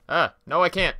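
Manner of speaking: high, loud panicked cry; panicked yell. Real, not acted. Near-miss panic while gaming.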